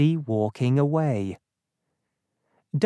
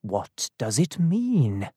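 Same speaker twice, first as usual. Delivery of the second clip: slow, lowered musing; inward thought; 3 semitones lower, slower than usual. A narrator's reading. Dunning wonders what the strange events mean, slow musing. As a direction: puzzle over it slowly, to yourself. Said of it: "he thought".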